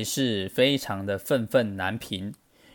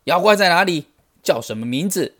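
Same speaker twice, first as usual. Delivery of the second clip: loud, raised angry question, easing at the end; fuming demand; 7 semitones higher, 5 dB louder than usual. A narrator's reading. Sun Wukong asks the monkeys about the monster, fuming. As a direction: demand answers in a flare of temper. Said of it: "气得火冒三丈".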